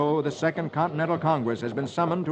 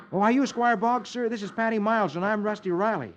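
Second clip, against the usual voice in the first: put-on much higher, eager young boy; a boy introducing himself politely; youthful voice; polite boy Rusty; 8 semitones higher than usual. Rusty greets the squire.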